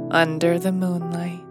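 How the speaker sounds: plain reading voice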